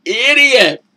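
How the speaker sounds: disbelief building to a jab; exasperated. Retold or imitated. Reacting to the TV couple, rising exasperated jab. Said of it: "And I was just like".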